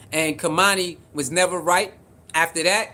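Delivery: ordinary talk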